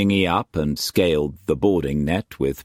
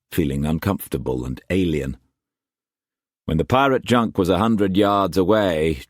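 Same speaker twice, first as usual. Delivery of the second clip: ordinary delivery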